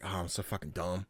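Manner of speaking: low, soft aside to himself; retold self-reproach. Host recalling awkward cosplayer chat, low quiet self-reproach. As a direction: drop low and quiet, embarrassed.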